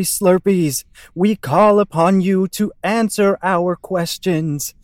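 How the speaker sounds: slow, solemn call to the spirits; ritual invocation. Acted. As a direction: intone it like a séance leader.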